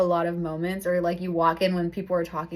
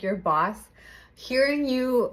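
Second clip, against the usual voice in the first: ordinary delivery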